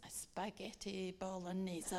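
low, soft hammed-up Italian accent; mock Italian. Traveller rehearsing her only Italian, low mock Italian accent. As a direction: practise it in bad mock Italian. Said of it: "trying to practice what I'm going to say".

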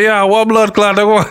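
put-on a playful Jamaican accent. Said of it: "in my Jamaican accent".

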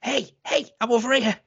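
high, eager call; imitating Veronica Cartwright calling out; mock calling out. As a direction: call out brightly to him. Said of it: "turns up to be like".

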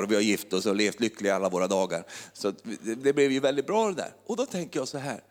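normal talking voice

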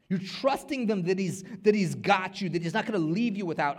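loud, high, fervent preaching; full-force preaching. Acted. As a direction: preach it out to the congregation. Acted scene then preaching.

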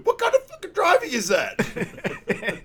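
high, loud mock screech; slow, hammed-up rant at a bad driver; cursing outburst. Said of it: "he curses at him too".

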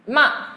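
short falling "ma"; fourth tone. Shown as a demonstration. Teacher running through four tones on one sound, "ma" falling sharply. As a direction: drop "ma" abruptly from high. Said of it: "Now, M A".

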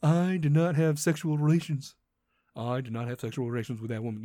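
mock the famous denial in a low voice; Bill Clinton impression. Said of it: "Bill fucking Clinton".

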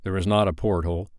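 usual speaking voice

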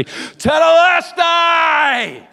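long, very high, very loud and slow triumphant cry; preacher voicing Jesus' final cry, huge drawn-out shout; victorious shout. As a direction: pull up with everything and roar it. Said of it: "he's gonna rise up and he's gonna say".

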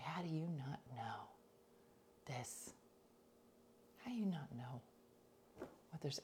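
whispered scolding in the pew; hiss-whisper an argument so nobody hears; hushed church argument. Retold or imitated.

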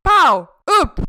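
sudden loud shout; a punchy loud call; loud summons. Retold or imitated. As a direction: bark them up onto the stage. Organisers summon her on stage.

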